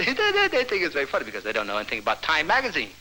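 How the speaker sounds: ordinary delivery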